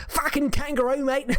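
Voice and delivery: badly done Australian accent yelling at a kangaroo; awful Aussie accent. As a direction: bark it like a cartoon Aussie bloke. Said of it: "that's an awful accent".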